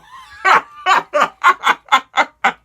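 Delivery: loud high laughing fit; cracking up at the fox excuse; big laugh. Real, not acted. Laughing at the wolf pretending to be a fox.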